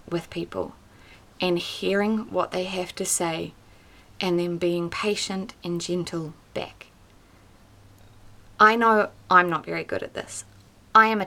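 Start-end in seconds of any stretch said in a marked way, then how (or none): none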